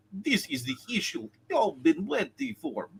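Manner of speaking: light impression of Eric Green; a hint of Eric Green's read. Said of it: "if I was doing Eric Green".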